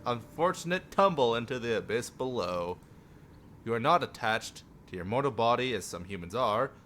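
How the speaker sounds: usual speaking voice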